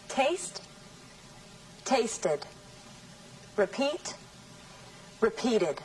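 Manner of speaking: slow, each word said alone with long pauses; clear word list. Shown as a demonstration. Teacher drills verb pairs for the class. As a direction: model each pair, present then past. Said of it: "I will say them in present tense and then in the past tense".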